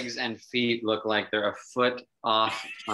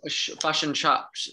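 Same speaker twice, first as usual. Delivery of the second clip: put-on New Zealand accent; 4 semitones higher than usual. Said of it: "I'll do New Zealand".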